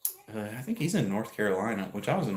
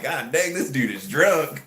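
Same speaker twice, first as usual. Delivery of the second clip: louder, slow mock disbelief; amused disbelief; 5 semitones higher, 8 dB louder, slower than usual. Reacting to the drunk streamer, loud amused disbelief. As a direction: drag it out, amazed. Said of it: "And I'm like".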